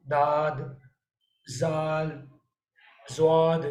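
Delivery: "दाद", "जाल", "ज़्वाद" read as the wrong versions of the letter; common mistakes. Retold or imitated. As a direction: list the mispronunciations slowly. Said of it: "पता नहीं क्या-क्या पढ़ते हैं". (ग़लत उच्चारण की मिसालें)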